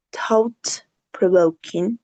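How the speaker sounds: slow, careful "Thought-provoking" with each syllable drawn out; slow teacher model. Shown as a demonstration. Teacher models "Thought-provoking" slowly for the class. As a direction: spell out "Thought-provoking" slowly for students to copy. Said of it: "Throat or thought".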